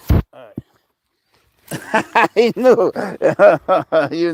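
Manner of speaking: high, loud bursts of laughter; laughing. Real, not acted. Laughing at his dog who knew, delighted.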